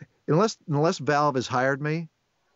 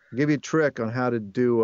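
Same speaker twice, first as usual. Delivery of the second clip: normal talking voice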